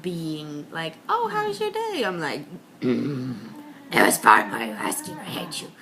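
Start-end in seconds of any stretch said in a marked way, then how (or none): 1.1-2.0 very high, sweet mock greeting; mimicking a cheerily attentive partner; imitated chirpy partner. Voices her partner's friendly question. 2.9-5.7 low grumpy growl dropping to a mutter; grudging, low-voiced mock hostility; acted sullen reply. Acts her sullen reply.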